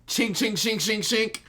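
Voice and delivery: high-pitched rapid metallic sound effect; comic slicing noises; imitated blade slashes. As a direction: voice quick sword strikes up high. Imitates blade strikes of attack.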